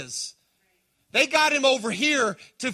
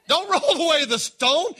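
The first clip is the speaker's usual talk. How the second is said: higher and louder, laughing through it; laughing plea; 7 semitones higher, 5 dB louder than usual. Acted. Martha begging Jesus, amused alarm. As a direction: beg him not to open the tomb, half laughing.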